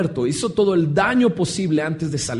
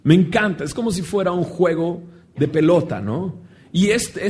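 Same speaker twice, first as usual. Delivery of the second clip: normal talking voice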